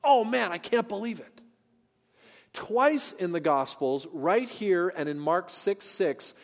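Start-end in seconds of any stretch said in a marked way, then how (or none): 0.0-1.4 higher-pitched mock amazement; astonishment. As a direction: act out an onlooker's disbelief.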